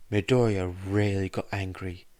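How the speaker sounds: plain reading voice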